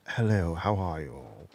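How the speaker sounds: low, soft, slow posh English voice; plummy Queen's English hello; posh English accent. Shown as a demonstration. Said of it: "I'm quite posh when I speak".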